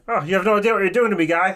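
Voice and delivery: high, fast put-on skeleton voice; skeleton guy voice. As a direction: rattle it off like a giddy skeleton. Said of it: "I'm gonna do skeleton guy".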